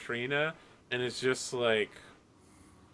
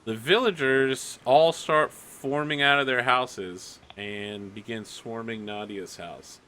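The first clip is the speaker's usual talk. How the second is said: ordinary delivery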